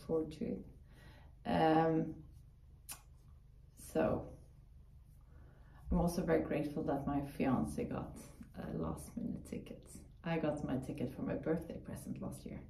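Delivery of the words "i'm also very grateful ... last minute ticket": usual speaking voice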